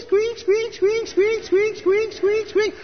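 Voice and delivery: very high squeaking hamster wheel noise; squeaky hamster wheel. Retold or imitated. Preacher telling graduates about his hamster, squeaky wheel noise. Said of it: "with the squeak".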